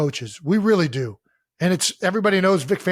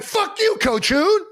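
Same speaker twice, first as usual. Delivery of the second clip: high-pitched mock yell at the coach; mock defiant outburst; 14 semitones higher, much slower than usual. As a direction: play at cursing out the coach.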